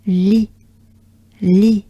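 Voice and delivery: "li" as bright "lee" with wide smile; smiling French li. Shown as a demonstration. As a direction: smile widely through "li". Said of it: "take a good breath".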